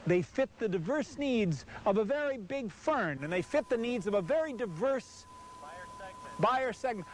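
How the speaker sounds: voice-over for an RV video; ad read. Acted. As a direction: pitch the buyer segment line like a commercial. Reads sales copy to camera.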